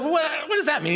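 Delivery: high, stammered mock confusion; imitating baffled Nicodemus. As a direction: play a flustered questioner tripping on the words. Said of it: "And he's like".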